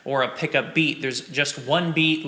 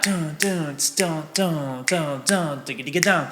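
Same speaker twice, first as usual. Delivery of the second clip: scat groove. Shown as a demonstration. Drum teacher scatting the groove for students. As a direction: sing the drum groove as dun and digga syllables. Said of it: "There's kind of a groove to that".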